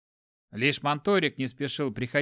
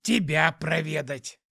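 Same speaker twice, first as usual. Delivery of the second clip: cheerful demon; slower than usual. A narrator's reading. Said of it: "весело ответил демон".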